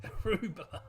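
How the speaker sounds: laughing. Real, not acted. Joke answer with laughter.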